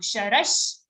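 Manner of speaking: normal talking voice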